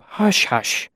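high, half-whispered soothing; gentle hushing. A narrator's reading. Trappist comforting the feverish boy, gentle hushing. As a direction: hush gently, almost on the breath. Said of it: "he said gently as he picked me up".

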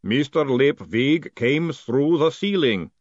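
slow golem voice, pitch slightly lifted. Acted. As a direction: report it ponderously as a golem. Golem reporting in character voice.

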